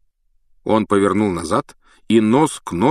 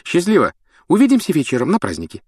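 cheerful goodbye; 9 semitones higher, 4 dB louder, faster than usual. A narrator's reading. Friends calling goodbye.

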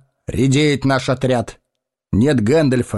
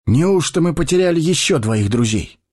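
sad; 10 semitones higher than usual. A narrator's reading. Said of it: "печально произнёс он".

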